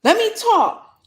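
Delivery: very high, loud mock demand; mock insistence. Partner demanding to be heard, high mock insistence. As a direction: push it up high, demanding the floor. Acting out someone demanding to speak.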